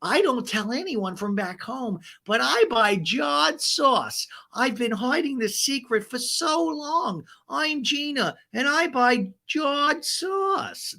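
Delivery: sing-song it high, drag the confession out; high, breathless Gina. Retold or imitated. Gina confessing to Travis about sauce, high and slow. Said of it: "She's like".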